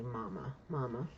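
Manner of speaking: soft, low, broken calling for Mama; whimpering Mama. Retold or imitated. Man clinging to his mother figure, softly whimpering. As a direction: murmur it like a grown man sobbing into her. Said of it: "I just keep hearing him say".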